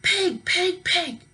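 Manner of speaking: high, loud repeated furious yells; angry shouting. A narrator's reading. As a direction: chase the pig, yelling. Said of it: "shouted Eugenia".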